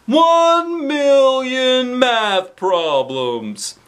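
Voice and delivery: very slow, loud, drawn out; insisting. Acted. Principal insists on his number, slow and emphatic. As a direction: lean hard on every word. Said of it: "Mister Klutz insisted".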